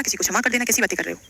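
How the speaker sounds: usual speaking voice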